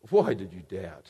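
very low, soft question; quiet, tender chiding; hushed reproach. Acted. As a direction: ask it gently, almost under the breath. Acts Jesus softly questioning Peter.

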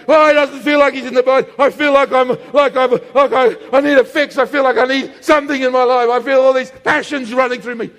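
loud, frantic outpouring; worked-up, desperate protest; agitated complaint. Acted. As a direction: blurt it out as if overwhelmed by craving. Acts addict's agitated complaint.

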